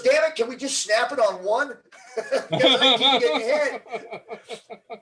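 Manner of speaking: high, loud, laughing complaint; voicing the hit guy while cracking up; laughing through a quote. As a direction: laugh through the teammate's gripe. Annoyed teammate quoted, laughing.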